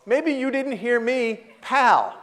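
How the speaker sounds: high, drawn-out mock challenge; quoted comeback. Man quotes his own retort to a visitor, pointedly. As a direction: spell it out slowly, landing on pal. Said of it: "quote unquote".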